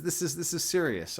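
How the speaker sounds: ordinary talk, nothing marked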